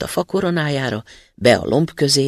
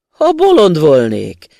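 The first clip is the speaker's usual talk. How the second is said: mocking student; 10 dB louder than usual. A narrator's reading. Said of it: "felelte a diák".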